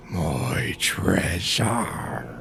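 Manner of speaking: deep, slow, breathy dragon whisper; ultra low rumble. Acted. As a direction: drag the words out low and hushed. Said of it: "a deep rumbling voice say".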